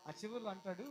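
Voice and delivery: ordinary talk, nothing marked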